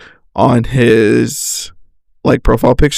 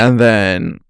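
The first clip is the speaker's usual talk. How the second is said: ordinary delivery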